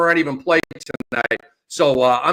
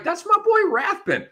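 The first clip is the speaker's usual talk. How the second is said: very high-pitched gleeful cheer; delighted fan. Acted. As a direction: act out a fan hearing a favorite announcer. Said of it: "you're like".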